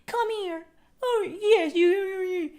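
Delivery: put-on high, gushing baby talk to a dog; coaxing pet talk. Owner on her knees coaxing the dog in, high baby talk. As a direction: coo sweetly up high to lure a pet in. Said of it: "get on my knees and be like".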